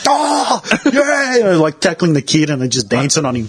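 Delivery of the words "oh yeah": gloating cheer. Retold or imitated. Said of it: "they're full on".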